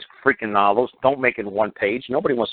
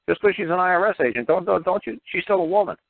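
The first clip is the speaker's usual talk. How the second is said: high-pitched protective telling-off; indignant scolding; 7 semitones higher than usual. Acted. Speaker defending his IRS-agent mom, indignant. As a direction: scold them on a woman's behalf.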